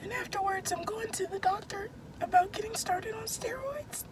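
high, soft, whispery squeak; barely voiced, straining for sound; voice gone. Real, not acted. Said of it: "I can't talk".

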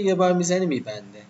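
ordinary delivery

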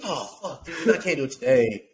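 ordinary delivery

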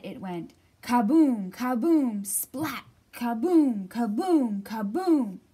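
loud, falling boom; booming kaboom. A narrator's reading. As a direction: boom it out like a rolling boulder. Rock crashing sound effects.

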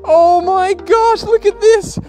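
usual speaking voice

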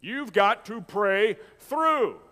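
"You've got to pray through" very slow, word by word; drawn-out exhortation. Retold or imitated. Preacher repeating the old timers' saying for emphasis, slow drawn-out exhortation. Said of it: "used to say it like this".

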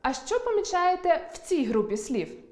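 normal talking voice

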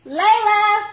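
loud high call across the house. Real, not acted. Mom calling her daughter Layla to come, loud call.

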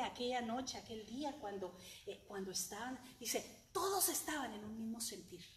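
ordinary talk

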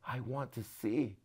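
soft, slow and steady; hushed, slow request; quiet focused plea. A narrator's reading. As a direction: say it simply and softly. Bartimaeus answering Jesus.

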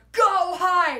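sharp, loud, high shout of an order; retold yell. A staff member shoving a kid forward, loud high yell. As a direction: bark it like a stranger shoving you along. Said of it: "and yelled".

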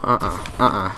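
ordinary talk, nothing marked

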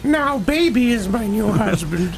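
hammed-up lovestruck character line; mock Rose voice.

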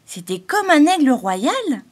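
high, loud, slow frightened question; shivering robin. A narrator's reading. Hector the robin fears a great eagle, high and frightened. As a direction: ask it trembling with fear. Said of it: "frissonna le rouge-gorge Hector".

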